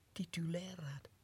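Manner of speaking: soft questioning whisper; puzzled whisper. Acted. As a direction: whisper it in doubt. Questioning his rank. (verbaasd gefluisterd)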